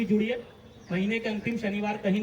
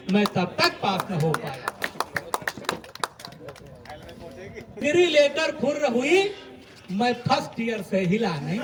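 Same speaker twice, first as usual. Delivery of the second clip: comic poetry recitation; 3 semitones higher, 4 dB louder than usual. Acted. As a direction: deliver each rhyme to a live audience. Recites a comic poem on stage. (हास्य कवि का लयबद्ध कविता पाठ)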